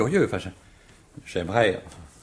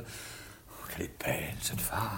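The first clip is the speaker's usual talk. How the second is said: breathless whispered aside, smitten; admiring whisper; 14 dB quieter, slower than usual. Acted. Visitor admiring the actress under his breath, whispering. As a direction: whisper it as she walks in.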